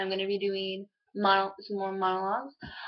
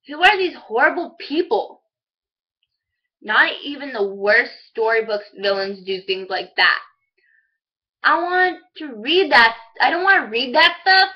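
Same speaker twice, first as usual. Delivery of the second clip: appalled outburst; 2 semitones higher, 7 dB louder than usual. Acted. Monologue character reacting to horrible news, appalled. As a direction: protest it in acted dismay, pitch swooping up.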